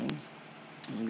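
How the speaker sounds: normal talking voice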